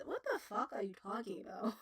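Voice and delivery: ordinary talk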